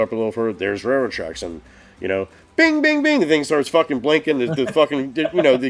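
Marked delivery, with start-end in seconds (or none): 2.6-3.2 high steady mock crossing bell; ding it out like the railway signal; bell imitation. Driver imitating the crossing bells for the stream, high and steady.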